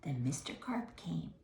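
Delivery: low, soft, breathy; suspense whisper. A narrator's reading. Reader building suspense for the children in a whisper. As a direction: hush for the scary part.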